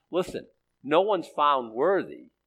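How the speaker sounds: ordinary delivery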